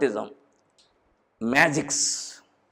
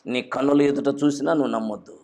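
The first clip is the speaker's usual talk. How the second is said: normal talking voice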